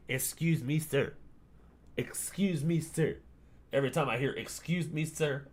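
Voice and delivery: a mild imitation of a panhandler asking. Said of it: "just being like".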